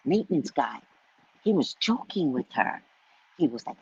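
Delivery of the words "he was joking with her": ordinary talk, nothing marked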